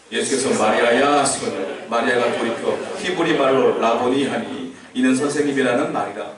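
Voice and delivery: 낮은 목소리로 또박또박 성경 봉독; scripture reading. Acted. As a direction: read the verses low and measured, like a lector. (성경을 낮고 차분하게 낭독)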